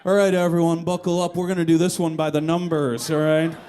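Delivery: rally the car like a bus driver; calm driver announcement. Retold or imitated. Driver calmly addressing panicking passengers, loud and steady.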